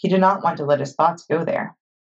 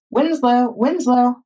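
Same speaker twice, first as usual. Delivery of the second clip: high, raised call for a lost animal; 8 semitones higher, 3 dB louder, slower than usual. Acted. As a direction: call the name into the distance. Calling for the missing donkey.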